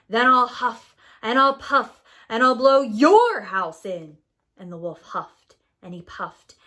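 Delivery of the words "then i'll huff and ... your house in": loud, furious threat; angry threat. A narrator's reading. Angry wolf threatens the second pig, loud. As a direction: snarl it through gnashed teeth. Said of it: "gnashed his teeth".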